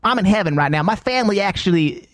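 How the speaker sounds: ordinary delivery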